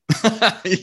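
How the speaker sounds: a quick high laugh; amused laugh. Real, not acted. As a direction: laugh at your own comeback. Laughing after the comeback.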